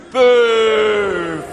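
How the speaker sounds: a big pantomime boo, shouted out; loud boo. Pretend. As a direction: boo at Moses with the whole room. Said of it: "we all go boo and hiss".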